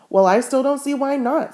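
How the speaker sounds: plaintive. Acted. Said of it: "said Winnie plaintively".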